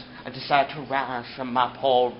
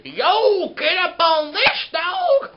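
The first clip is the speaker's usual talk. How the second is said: much higher and louder, yelling street slang; slang example; 14 semitones higher, 8 dB louder, much slower than usual. Acted. Teaching vernacular, yelling an example of street slang, much higher and louder. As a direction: shout the slang like a hyped dude. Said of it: "which means normal or informal language".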